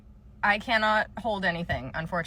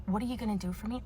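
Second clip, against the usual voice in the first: softer, flat mock echo with a lift on are; mocking quote; 8 dB quieter, faster than usual. Clerk mocking what customers say, quiet contemptuous echo. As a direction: parrot the line with contempt. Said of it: "I fucking hate when people say that to me".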